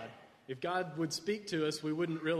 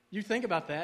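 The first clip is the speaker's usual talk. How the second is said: ordinary talk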